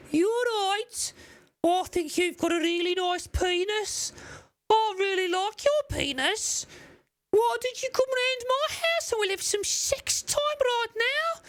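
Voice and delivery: very high-pitched mock Brummy come-on; Brummy accent. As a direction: do a squeaky, unsexy Brummy lover. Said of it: "Brummy accents in England".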